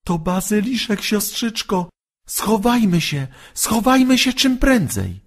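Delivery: high, urgent frightened voice; frightened urging. A narrator's reading. Maciek urges his sister to hide, high frightened voice. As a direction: hurry your sister into hiding. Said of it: "drżącym głosem Maciek".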